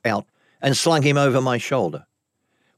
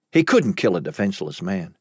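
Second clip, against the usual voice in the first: plain reading voice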